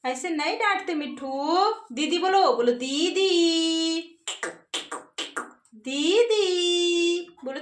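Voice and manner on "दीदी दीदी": very slow, stretched word; coaxing a parrot. Shown as a demonstration. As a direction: hold each syllable long for a bird to copy. Models word for parrot to repeat. (तोते को बहुत खींचकर सिखाना)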